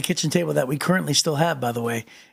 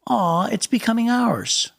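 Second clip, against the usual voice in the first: fond wife's reply; 6 semitones higher than usual. Retold or imitated. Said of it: "immediately she goes".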